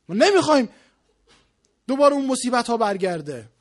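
loud, high, driving sermon voice; forceful preaching. Real, not acted. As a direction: exhort the crowd at full force.

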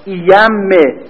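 ordinary delivery

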